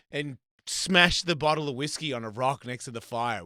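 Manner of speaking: ordinary delivery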